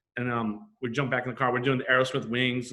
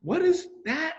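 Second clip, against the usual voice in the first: baffled; slower than usual. Retold or imitated. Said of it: "And I'm like".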